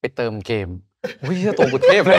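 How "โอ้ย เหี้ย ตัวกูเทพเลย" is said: higher, louder laughing boast. Real, not acted. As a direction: laugh through a smug self-mocking brag. Mocking his own gamer logic.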